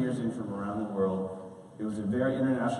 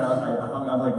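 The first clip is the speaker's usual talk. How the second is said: normal talking voice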